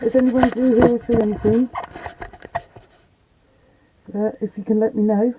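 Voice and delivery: ordinary talk, nothing marked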